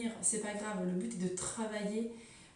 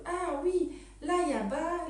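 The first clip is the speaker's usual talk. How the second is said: excited pupils; 9 semitones higher, 4 dB louder than usual. Retold or imitated. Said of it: "ils se sont moins folles".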